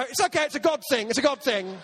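high, fast, flustered explaining; flustered excuse. Retold or imitated. Caught in the dark, flustered explaining to whoever enters.

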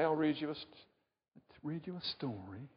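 ordinary talk

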